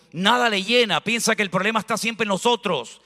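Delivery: ordinary delivery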